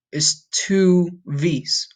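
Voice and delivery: very slow, stretched "two V's"; slow emphasis. Shown as a demonstration. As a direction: draw out "two V's" to explain. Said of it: "So what you're saying in Spanish".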